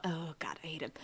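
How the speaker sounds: whispered, half under breath; exasperated whisper. Real, not acted. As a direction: hiss it out in frustration. Venting about hated character.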